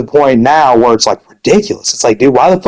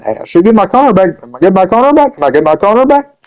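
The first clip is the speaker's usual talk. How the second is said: mock pleading, pitched higher; begging Boger; 5 semitones higher, faster than usual. Mimicking a gamer begging for his corner back. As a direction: whine it like a begging friend. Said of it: "I've been begging".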